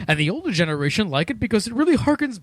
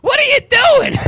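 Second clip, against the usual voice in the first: much higher, cartoonish squawk; weird random voice; 4 dB louder than usual. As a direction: push the voice way up into a silly character. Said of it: "I was just doing a weird random voice".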